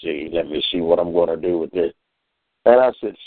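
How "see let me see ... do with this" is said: lightly voiced dog. Daughter voicing the dog in a video, lightly lower. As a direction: mumble along as the dog deciding. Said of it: "she was talking like the dog".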